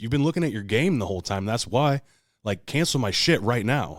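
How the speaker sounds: heated retelling, slowing on the last demand; retold angry. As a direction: replay the blow-up at the clerk. Retold argument with hotel clerk.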